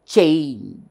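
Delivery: CHANGE as one long falling syllable; demonstrated pronunciation. Shown as a demonstration. Teacher shows a one-syllable word, demonstrated pronunciation. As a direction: stretch "change" out in a single stroke. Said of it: "Observe the pronunciation".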